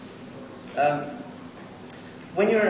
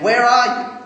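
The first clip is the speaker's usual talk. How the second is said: calling out; 7 semitones higher, 10 dB louder, slower than usual. Acted. God calling out in Genesis.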